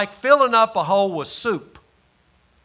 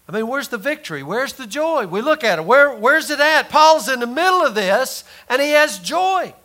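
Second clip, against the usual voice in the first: rising preacher's cry, higher and louder; building preaching; 8 semitones higher, 5 dB louder than usual. Acted. Preacher rousing the congregation about Paul's joy, building loud. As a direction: climb the pitch toward a full preach.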